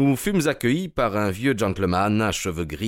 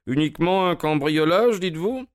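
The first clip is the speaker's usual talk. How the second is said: high, rising disbelief; incredulous echo; 10 semitones higher than usual. A narrator's reading. Holmes reprend les mots de l'inspecteur, incrédule. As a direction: question his word, amused. Holmes echoes inspector, questioning.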